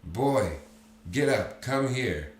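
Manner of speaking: put-on sudden loud, high bark at the boy; exploding shout. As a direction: burst out calling the boy over. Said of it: "He suddenly exploded".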